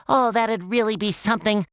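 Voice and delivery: ordinary talk, nothing marked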